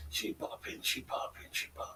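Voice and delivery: whispered hype. Real, not acted. Hyping up a woman on stream.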